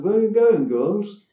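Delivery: high, loud call out of the window; man calling from a taxi. Retold or imitated. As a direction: call it out to girls on the street. Passenger calls from taxi window.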